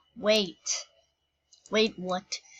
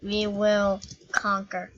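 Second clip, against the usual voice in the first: normal talking voice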